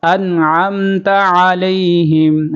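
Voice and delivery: انعمت علیہم with a throaty ع; sounded ain. Shown as a demonstration. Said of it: "عین کی آواز نکالیں گے،".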